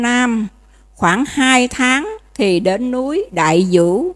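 slow ceremonial reading of a holy text; solemn scripture reading. Acted. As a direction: read the sutra line with weight. Recites the sutra narrative.

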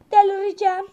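loud burst of frustration; exasperated outburst. Real, not acted. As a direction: blurt it out in exasperation.

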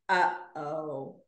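a lightly voiced husband sensing trouble; imitating husband. Husband on the couch watching her open the box, in a low, soft ominous voice.

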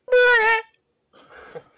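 drawn out, very high squeaky call; silly high voice. Pretend. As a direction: stretch the name in a falsetto. Calling name in silly voice.

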